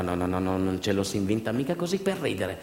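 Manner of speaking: usual speaking voice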